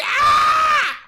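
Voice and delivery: very high, very loud mock screech; demon robot baby screech. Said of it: "A demon robotic baby".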